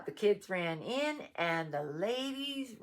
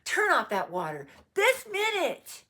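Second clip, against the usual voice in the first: high, very loud scolding command; exasperated storybook yell at children; shouted order; 11 semitones higher, 10 dB louder than usual. A narrator's reading. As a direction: shout it, demanding instant obedience. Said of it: "shouted Missus Rogers".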